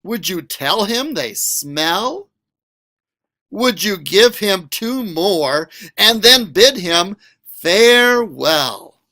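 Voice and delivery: lively, sing-song picture book read; reading to children. A narrator's reading. Teacher reading a picture book to students, animated. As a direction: read it to a class with big expression.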